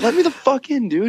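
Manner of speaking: very high mock yell; retold yelling. Freezing guy to friend blocking the doorway, yelling.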